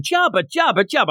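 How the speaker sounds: put-on high baby-talk chatter; babbling toddler. Imitating little Graham chattering away, babbling toddler. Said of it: "Graham's going".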